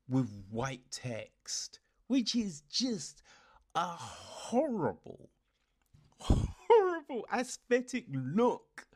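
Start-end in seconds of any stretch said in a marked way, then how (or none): 2.1-8.9 disgusted. Real, not acted. Ranting about screen design.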